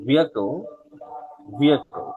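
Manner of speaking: vehicle as "VEE-a-ko", silent h; model pronunciation. Shown as a demonstration. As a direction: drill vehicle, falling each time. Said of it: "এটার উচ্চারণ হচ্ছে".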